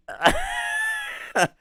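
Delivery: high, helpless burst of laughter; shrieking laugh. Real, not acted. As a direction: crack up in a high squeal. Cracking up at the clip.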